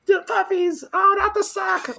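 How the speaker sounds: high, slow squealing cry; mock high shriek; squealing announcer imitation. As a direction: shriek it like a panicked commentator.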